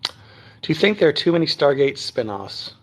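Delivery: ordinary talk, nothing marked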